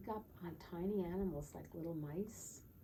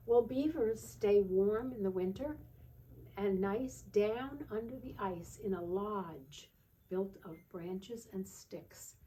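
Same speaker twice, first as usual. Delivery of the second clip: sing-song picture-book reading voice; lilting storybook read-aloud, slowing on the bear lines; reading to children; 2 semitones higher, 7 dB louder, slower than usual. Acted. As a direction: read it like a bedtime book for a toddler.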